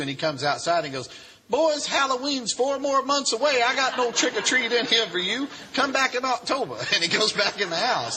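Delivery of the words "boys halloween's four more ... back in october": unfazed preacher. Retold or imitated. Preacher to hooded Klansmen in his yard, unfazed.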